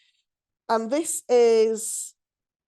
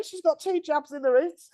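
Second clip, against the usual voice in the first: ordinary talk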